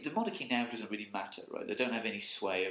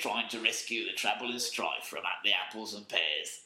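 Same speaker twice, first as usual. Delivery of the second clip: mock Cockney game hero; slower than usual. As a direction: play the Cockney Pearly King character. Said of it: "That voice I'm doing".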